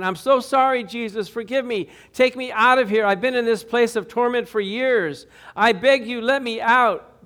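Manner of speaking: wailing plea. Acted. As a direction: beg Jesus to be let out. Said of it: "Great wailing sobs".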